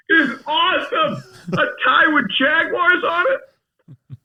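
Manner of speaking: high, loud excited outburst; thrilled exclamation. Retold or imitated. Actor seeing his seventies wardrobe tie, high loud thrilled outburst. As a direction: cry out in delighted amazement.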